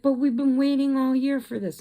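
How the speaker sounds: high, loud whine; whiny protest. Retold or imitated. Daughter begging her parents to keep the trip, high and whiny. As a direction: plead like a cheated teen. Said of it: "And she's like".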